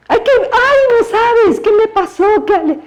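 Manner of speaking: overexcited gossip, pitch way up; gushing excitement. Pretend. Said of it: "Yo nunca diría".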